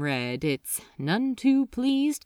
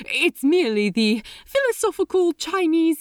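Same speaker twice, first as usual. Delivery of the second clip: hurried explaining; 7 semitones higher, 4 dB louder, faster than usual. Acted.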